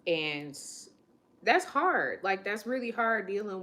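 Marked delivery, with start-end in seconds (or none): 1.4-3.6 emotional. Real, not acted. Emotional about workplace struggle.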